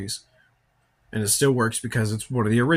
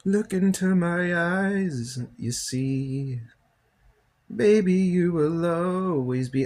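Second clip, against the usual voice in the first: high, slow, put-on crooning; hammed-up hypnotic charm, drawn out slowly; mock seductive voice; 8 semitones higher, much slower than usual. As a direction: lure someone in with a silly, slow sing-song. Hams up a seductive movie voice.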